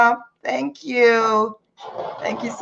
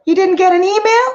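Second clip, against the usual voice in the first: startled question; 9 semitones higher, 5 dB louder than usual. Retold or imitated. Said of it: "I'm like".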